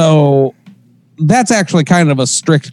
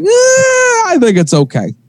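high, careless eh; dismissive shrug; 13 semitones higher than usual. Retold or imitated. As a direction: brush it off lightly. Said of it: "we're like".

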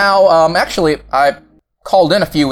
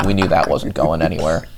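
ordinary talk

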